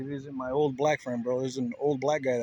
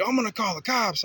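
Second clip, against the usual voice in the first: mock threat; 7 semitones higher, 4 dB louder than usual. Voicing the guy's threat.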